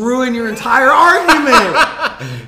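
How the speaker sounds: slow, high laughing jab. Real, not acted. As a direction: laugh through the taunt. Mocking friend's argument.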